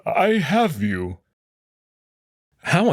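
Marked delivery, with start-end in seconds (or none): 0.1-1.2 hushing comfort. Acted. Amicus comforts dying Marco.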